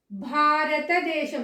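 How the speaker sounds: slow భారతదేశం with strong bh; careful, stretched భారతదేశం; aspirated భా. Shown as a demonstration. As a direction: puff out భా in భారతదేశం. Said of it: "పలకడం ఎలా పలకాలి".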